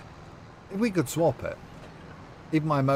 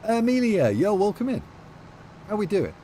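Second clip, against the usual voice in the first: excited greeting; 9 semitones higher than usual. Real, not acted. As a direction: call out a big cheery welcome. Greeting a newly arrived viewer.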